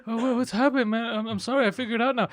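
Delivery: high-pitched imitation of a flustered friend; mock freak-out. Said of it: "He's freaking out".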